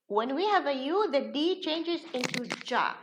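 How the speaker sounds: ordinary talk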